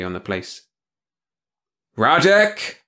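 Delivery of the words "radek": loud barked name; harsh boss bark. Acted. Hard-voiced boss calls.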